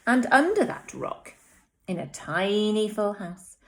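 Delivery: put-on lively read-aloud to young children; animated storytime voice; story narration. A narrator's reading. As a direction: read it like a bedtime story for little ones. Teacher reads picture book aloud.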